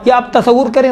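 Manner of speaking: ordinary talk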